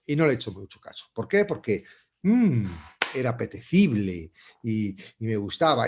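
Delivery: normal talking voice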